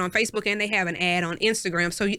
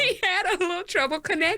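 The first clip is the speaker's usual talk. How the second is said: giggling through it, pitched up; laughing repeat; 10 semitones higher than usual. Acted. Telling the co-host, laughing repeat. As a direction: laugh as you repeat the joke to your co-host.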